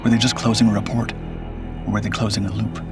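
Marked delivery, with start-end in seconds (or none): none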